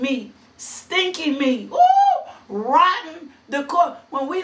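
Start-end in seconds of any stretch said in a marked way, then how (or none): 1.7-2.3 a loud high ooh with a laugh; high laughing whoop. Real, not acted. Preacher reacts to rotten meat.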